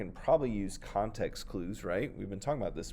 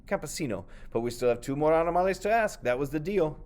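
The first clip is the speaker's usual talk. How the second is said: higher, louder insistent voice; insistent snake; 6 semitones higher, 5 dB louder than usual. Acted. The snake reminding the farmer of the deal, higher and louder. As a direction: hold him to the deal.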